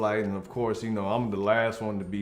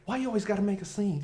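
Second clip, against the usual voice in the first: sassy complaint; 8 semitones higher, 4 dB quieter, faster than usual. Retold or imitated. Partner voiced in argument.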